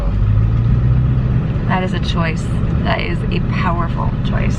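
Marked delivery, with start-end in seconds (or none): 0.0-1.7 mock deep echo. Wife echoing husband's word, in a deep slow mock voice. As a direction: drop way down and stretch it out slowly.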